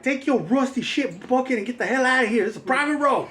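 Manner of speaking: high-pitched hammed-up old man yelling at hippies; mock angry yelling. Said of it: "it's like".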